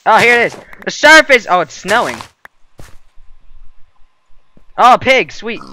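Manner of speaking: high, loud thrilled cheering; excited. Real, not acted. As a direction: yell it out with real joy. Reaching surface, cheering.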